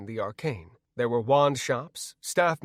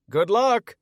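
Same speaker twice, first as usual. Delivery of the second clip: high, loud call; calling out loudly; yelled; 8 semitones higher, 7 dB louder than usual. A narrator's reading. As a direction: shout it across the street. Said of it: "I yelled".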